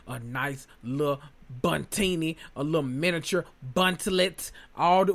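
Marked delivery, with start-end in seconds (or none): none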